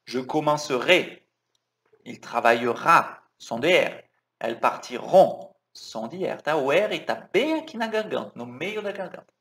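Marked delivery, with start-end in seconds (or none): 0.1-5.5 French R pressed hard in "travaillera"; stressed R sound. Shown as a demonstration. Teacher makes students hear the strong R. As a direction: lean on every throaty R. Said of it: "Olha lá".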